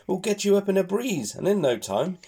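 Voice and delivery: high chirpy bird voice; friendly twittering bird. Acted. Bird chirping to scared piglet, friendly high chatter. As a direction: chatter brightly like a little bird. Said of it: "tweets in a friendly chatter".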